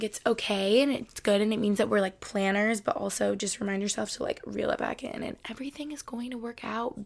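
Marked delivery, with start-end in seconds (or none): 5.2-7.0 soft reassurance. Real, not acted. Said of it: "Baby girl".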